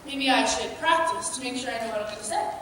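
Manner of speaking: ordinary talk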